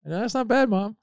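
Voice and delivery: very high teasing praise; mock praise. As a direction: tease mom about her comeback.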